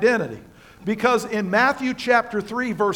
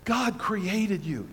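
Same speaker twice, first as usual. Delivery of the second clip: slow, softer "God created you", each word weighed; soft emphatic; 3 semitones lower, 7 dB quieter, slower than usual. Real, not acted. Preacher tells the congregation softly and slowly. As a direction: lay it down gently and slowly. Emphatic pause after long silence.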